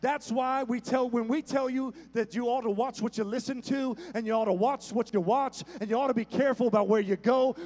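ordinary delivery

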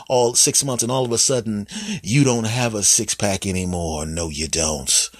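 ordinary talk, nothing marked

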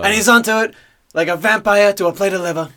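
high, loud, relishing commentator impression; Ray Hudson. Podcast guy quoting a commentator's vampire simile, excited impression. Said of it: "that's, uh, Ray Hudson".

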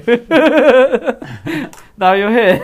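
high, loud laughing Aussie bloke; a shocked Aussie tradesman, cracking up. Pretend. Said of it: "teleporting mate reaction, acted".

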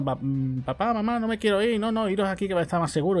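lightly voiced frightened child; crying children. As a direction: imitate a scared kid pleading with their parents. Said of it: "incluso los niños están llorando".

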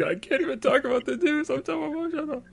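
high falsetto mock of a weepy friend; mocking emotional friend. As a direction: squeak it out like someone about to cry. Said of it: "He's like".

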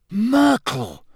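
high shocked outburst of a name; startled indignant boy; outraged. A narrator's reading. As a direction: cry her name in outrage. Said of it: "Harry said in outrage".

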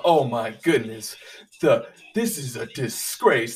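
slow mocking pearl-clutcher voice; mock prissy dismay. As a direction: drag it out like a scandalized prude. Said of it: "the mo".

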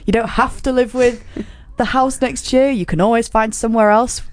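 high, teasing mock-harsh advice; mock mean. Real, not acted. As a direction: play it mean as a joke. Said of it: "That's why [name]'s being so mean".

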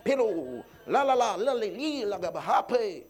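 mocking sing-song gibberish; sing-song mock babble; mock tongues babble. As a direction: parody airy spiritual babbling. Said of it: "bubbling in the air".

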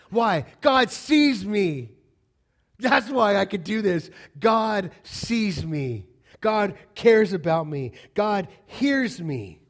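loud, high rising preacher call; the preacher urges the church, loud and high; full-force preaching. Acted. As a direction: preach it out to the crowd.

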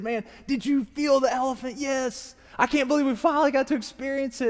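high-pitched, thrilled retelling; excited. Acted. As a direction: act out friends bursting with excitement. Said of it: "they just were so excited".

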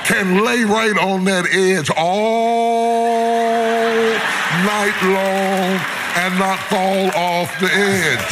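slow, high, stretched-out preaching; drawn out. Acted. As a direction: pull every word long at the top.

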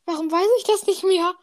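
high, fast dismay; baffled at yourself; surprised. Real, not acted. Dismayed at forgetting.

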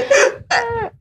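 sharp high gasp, imitating someone moved to tears; mock tearful gasp. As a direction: gasp like a sister welling up at a TV ad. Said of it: "se ponían así de".

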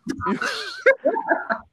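high, real laugh; high giggle. Real, not acted. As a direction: giggle at the memory. Laughing at the insult.

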